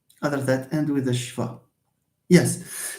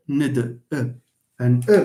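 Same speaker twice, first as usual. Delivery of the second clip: normal talking voice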